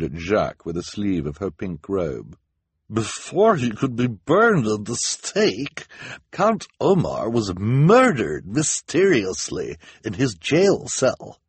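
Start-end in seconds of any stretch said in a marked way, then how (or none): 2.9-11.4 high, slow, swooping delivery of juicy news; enthusiastic. A narrator's reading. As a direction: relish each word, drawn out and loud. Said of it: "she said enthusiastically".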